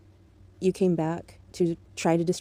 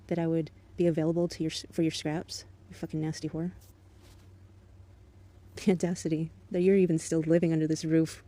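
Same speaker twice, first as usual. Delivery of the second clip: ordinary talk, nothing marked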